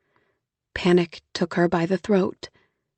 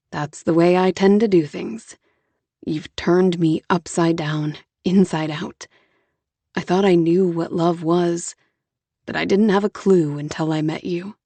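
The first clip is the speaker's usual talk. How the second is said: Jonah, patient and gentle; 3 semitones lower than usual. Acted. Jonah voiced.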